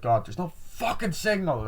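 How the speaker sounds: high-pitched exasperated complaint; mock frustration. Retelling a failed nine one one call, mock frustration.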